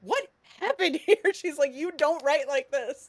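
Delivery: high, mock-appalled question; incredulous editor. Author quoting her editor seeing the draft, incredulous. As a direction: react aghast at a bad draft, half amused. Editor's dismayed reaction acted out.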